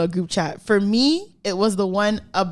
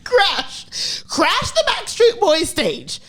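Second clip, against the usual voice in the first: mock mom voice; 8 semitones higher, much slower than usual. Repeats mom's words, mocking.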